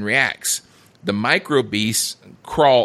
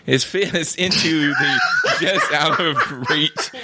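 laughing; 5 semitones higher, slower than usual. Real, not acted. Laughing while reading the script.

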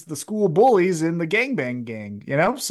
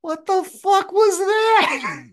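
slow, high, disbelieving squeal; shocked high voice; much slower than usual. Retold or imitated. The Acclaim on the ramp, mock shocked high voice. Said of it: "Acclaim walking up the ramp go".

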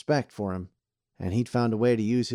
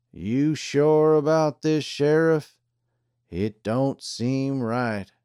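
slow deputy voice; 5 semitones higher, 4 dB louder, much slower than usual. A narrator's reading. Pete the deputy doubting.

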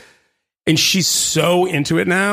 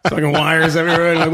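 usual speaking voice

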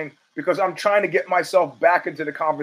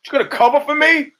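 pleading mock outrage, rising; imitating Trump; 8 semitones higher than usual. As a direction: Trump begging for cover, pitched up. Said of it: "I can see him going".

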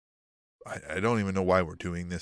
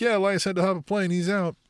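higher, quick put-on reply; excuse-making wrestler; 10 semitones higher, 3 dB louder than usual. Podcaster voicing returning wrestler's alibi, quick excuse-making. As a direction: toss off the alibi in a raised voice. Acting out Ezekiel's breezy excuse.

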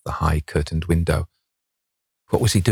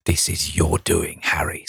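bitter. A narrator's reading. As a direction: blame him bitterly, dropping away on the name. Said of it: "said the painter bitterly".

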